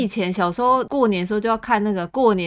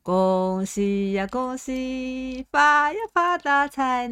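voice cracking; 5 semitones higher, much slower than usual. Real, not acted. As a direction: voice cracks on the high notes. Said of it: "破音了".

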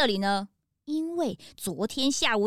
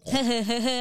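shy giggle; 4 semitones lower than usual. Acted. Shy laugh after praise.

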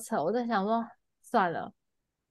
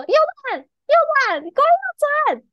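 high, loud shouted command; mock panicked shout, much higher and louder; shouted order; 6 dB louder than usual. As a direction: yell the turn order in a panic. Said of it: "他就突然就说".

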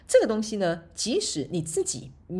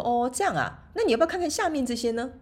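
a lightly voiced friend, pitched much higher; 6 semitones higher than usual. Voicing her friend.

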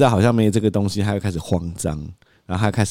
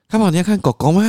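much higher, sing-song question; coaxing toddler; 10 semitones higher, 4 dB louder than usual. Acted. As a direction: tempt the child sweetly. 父亲哄孩子. (高音哄小孩)

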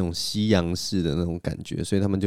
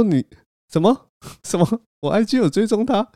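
shocked disbelief; 13 semitones higher than usual. Retold or imitated. Caught out by a friend, blurting shocked disbelief. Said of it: "然后就说".

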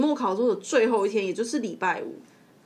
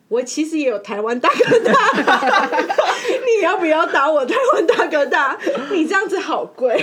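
laughing; 5 semitones higher, 7 dB louder than usual. Real, not acted. Teasing the angry girlfriend.

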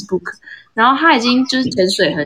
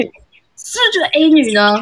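accusing repeat; 8 semitones higher than usual. Retold or imitated. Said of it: "那个男就说：".

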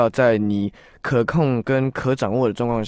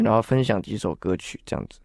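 ordinary talk, nothing marked

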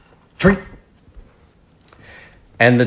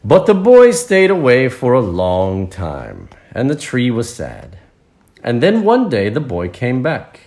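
raised, projected storybook reading, easing at phrase ends; story narration; 5 semitones higher, 8 dB louder, slower than usual. A narrator's reading. Narrator tells children of the boy's return, storytelling voice. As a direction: read it out to listening children.

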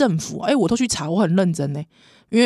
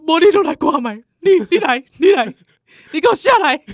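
calling out loudly, pitched up; retold yelling at husband; 7 semitones higher, 6 dB louder than usual. Wife shouting at her husband in the hot spring, loud and high. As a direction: yell at the husband to get down here. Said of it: "我说".

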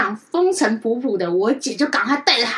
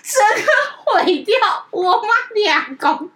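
high, drawn-out words breaking with laughter; laughing exasperation; 11 semitones higher, 4 dB louder, much slower than usual. Real, not acted. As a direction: wail it through helpless laughter. Laughing at the disaster. (笑到拉长音高喊)

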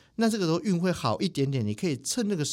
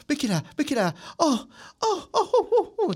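high, loud trance chanting, mock medium; imitating spirit mediums; 14 semitones higher, 4 dB louder than usual. Friend mimics temple mediums in a trance, high loud whoops. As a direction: ham up a possessed medium's whooping cries. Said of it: "我是在模仿他们".

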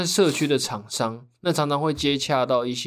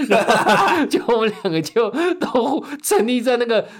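laughing; 8 semitones higher, 4 dB louder than usual. Real, not acted. Laughs about the awkward moment.

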